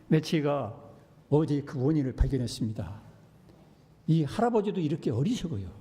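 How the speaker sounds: ordinary talk, nothing marked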